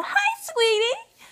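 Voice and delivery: put-on very high, slow squeaky girlish greeting; Harley Quinn voice. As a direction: squeak it out sweetly like a cartoon girl. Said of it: "my Harley Quinn voice".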